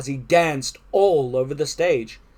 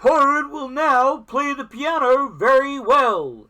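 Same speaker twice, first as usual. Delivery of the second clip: high, slow stage announcement; strange announcer; 10 semitones higher, 4 dB louder than usual. A narrator's reading. Strange voice introduces Toad's piano act, slow high announcer. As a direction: announce the act in drawn-out words. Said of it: "said the strange voice".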